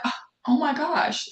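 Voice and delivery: sudden high oh my gosh, caught off guard; the penny drops, up high; surprised gasp. Retold or imitated. Realizing God's lesson, she exclaims in surprise. Realizing the story matched her day.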